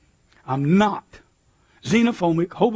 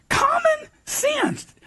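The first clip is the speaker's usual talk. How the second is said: mock; much slower than usual. Repeats phrase mockingly.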